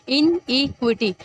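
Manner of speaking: इनइक्विटी said slowly, in, क्वी, टी; slow syllable demo. Shown as a demonstration. Teacher sounding out the word for students, slowly. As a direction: pronounce इनइक्विटी slowly and clearly. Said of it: "in आएगा ई, फिर क्वी".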